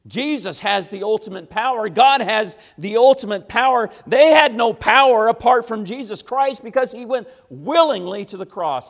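loud, high, emphatic declaration; full-force preaching. Acted. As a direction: hammer home who holds the power.

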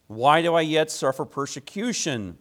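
slow, solemn reading of a Bible passage; scripture reading. Acted. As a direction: read the verses measured and weighty, with pauses. Reads scripture before the sermon.

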